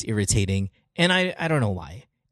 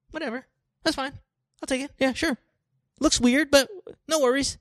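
high, dismissive shrug of a voice; grudging acceptance; 11 semitones higher than usual. Acted. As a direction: brush it off with mock tolerance.

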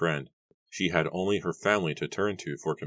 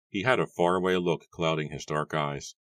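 ordinary delivery, nothing marked

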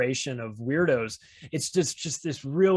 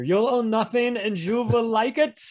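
mock German accent, much higher and louder; Klaus Schwab Junior German accent; 8 semitones higher, 4 dB louder than usual. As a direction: proclaim it like a villain in a thick German accent. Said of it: "A K A Klaus Schwab Junior".

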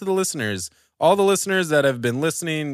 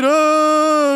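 mock scream; 11 semitones higher, 5 dB louder than usual. Spooky episode, scary shriek.

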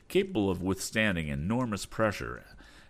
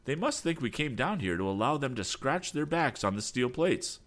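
higher, eager cry; excited cry; 7 semitones higher than usual. Acted. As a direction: call it out with wonder. Tom continues excited.

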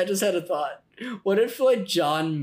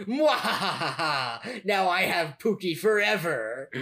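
slow evil villain laugh and gloat; slow theatrical villain menace; slower than usual. Retold or imitated. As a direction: cackle like a cartoon villain. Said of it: "be like".

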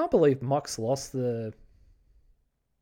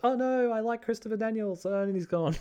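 higher, hammed-up little cry of disappointment; mock dismay; 10 semitones higher than usual. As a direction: play at being crushed, half joking. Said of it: "a part of me that was like".

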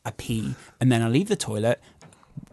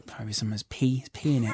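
mumbled; 3 semitones lower, 8 dB quieter than usual. Retold or imitated. Feigning innocence to housemate.

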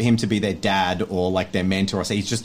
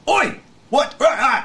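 a much higher, louder startled crowd shout; startled crowd yell; 5 dB louder than usual. Retold or imitated. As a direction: yelp like confused people in the dark.

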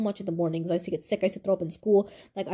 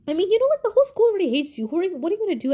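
high, taunting self-talk; mock inner voice; 8 semitones higher, 3 dB louder, much faster than usual. Mocking herself about going to the graduation party, taunting. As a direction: sneer it at yourself, pitched up and louder.

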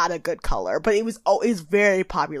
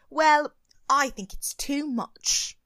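Twiggy impression; 5 semitones higher, much slower than usual. Twiggy quoted as judge.

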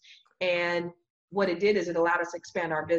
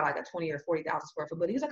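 normal talking voice

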